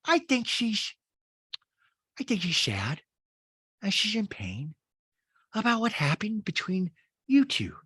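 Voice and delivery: put-on Rinna with a breathy hushed middle; fake-tender concern; Rinna, mock sympathy. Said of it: "And Rinna goes".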